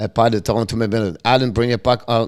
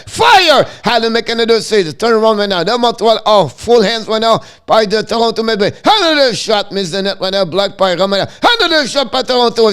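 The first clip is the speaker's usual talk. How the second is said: much higher and louder, calling the rush; excited play-by-play; 10 semitones higher, 6 dB louder than usual. Real, not acted. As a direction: shout the scramble in front of the net like a live goal call. Goal-mouth scramble, excited play-by-play.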